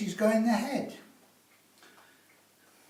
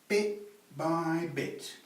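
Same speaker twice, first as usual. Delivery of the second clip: slow emphasis; much slower than usual. Shown as a demonstration. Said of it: "repeats motto".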